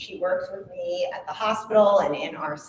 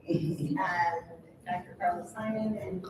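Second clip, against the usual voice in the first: ordinary talk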